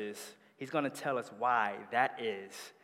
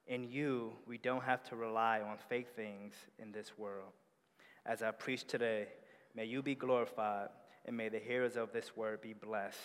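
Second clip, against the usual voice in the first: soft, reverent prayer voice; hushed pastoral prayer; 5 dB quieter than usual. Acted. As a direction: pray it quietly before the sermon. Pastor leads opening prayer.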